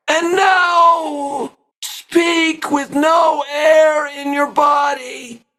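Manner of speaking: high, slow, squeezed voice with no air behind it; airless strained voice. Shown as a demonstration. Coach demonstrating a voice with no air left, strained and high. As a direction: talk with empty lungs, strained and thin. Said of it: "and you will sound like this".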